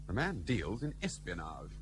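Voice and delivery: hushed confidence. Acted. Confiding a secret about Jacob.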